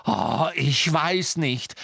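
slow, stretched sarcastic cry; biting sarcasm. A narrator's reading. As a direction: scream it with scathing sarcasm. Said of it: "schrie Hermine mit beißendem Sarkasmus".

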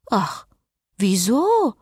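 mock innocence, rising on wieso. A narrator's reading. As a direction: feign surprise to tease him. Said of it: "Spottete Cecile". (spöttisch, gespielt unschuldig)

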